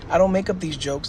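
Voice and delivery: ordinary talk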